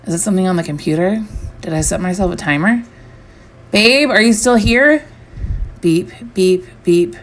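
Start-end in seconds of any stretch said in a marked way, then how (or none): 3.7-5.0 raised, high call, drawn out slowly. A narrator's reading. Calling out to absent partner. 5.8-7.2 short, evenly spaced beeps held on one pitch; imitated alarm beeps. A narrator's reading. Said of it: "the alarm or the timer".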